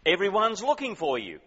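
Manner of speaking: urgent exclamation in a read-aloud quote; exclaiming. A narrator's reading. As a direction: exclaim it high and loud, reading out their words. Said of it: "they exclaimed".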